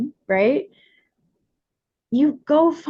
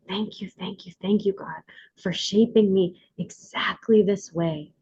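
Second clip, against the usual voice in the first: whispered thanks; 5 semitones lower, 5 dB quieter than usual. Shown as a demonstration. Woman thanking God for her body, whispered. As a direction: breathe the thanks out like a prayer.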